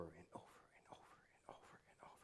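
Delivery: fast unvoiced whisper. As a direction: hurry it under your breath like a looping thought. Whispered over and over.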